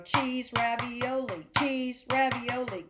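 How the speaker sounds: "cheese" and "ravioli" run together without the rests; rushed guessing. Shown as a demonstration. Music teacher showing what you don't want to do, rushed guessing. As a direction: hurry "cheese, ravioli" along, skipping the gaps. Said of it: "What you don't wanna do is".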